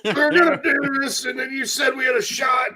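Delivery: high-pitched imitation of an aggrieved fan; mock cussing rant. Host imitates friends blaming him, mock rant. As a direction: whine the accusation high, as a parody. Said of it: "they'll just sit there and start cussing at me".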